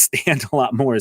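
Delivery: ordinary delivery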